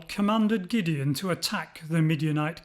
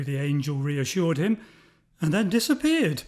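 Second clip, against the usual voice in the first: ordinary delivery, nothing marked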